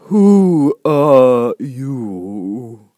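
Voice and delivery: very slow, drawn-out and loud; languid sleepy caterpillar. A narrator's reading. Caterpillar asks Alice who she is, languid and slow. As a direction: stretch each word out lazily, like a drowsy smoker. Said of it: "in a languid, sleepy voice".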